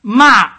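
"Ma" very loud, high and sharply falling; emphatic falling "Ma", Mandarin fourth tone. Shown as a demonstration. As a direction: throw "Ma" down forcefully from a high start. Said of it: "fourth tone".

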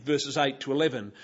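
ordinary talk, nothing marked

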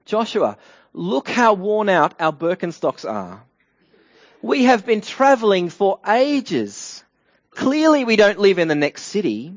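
high, emphatic mock weary traveller; hammed-up plea. Gibeonites insist to Joshua they came from afar, hammed-up plea. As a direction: plead the case theatrically, stretching the complaint about travelling.

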